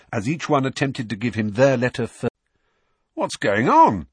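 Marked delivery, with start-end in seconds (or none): 3.1-4.1 raised, loud burst of amazement; amazed. A narrator's reading. Ron reacting to the pile of owls, amazed. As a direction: blurt it out in astonishment. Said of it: "Ron asked in amazement".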